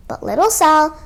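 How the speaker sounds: ordinary delivery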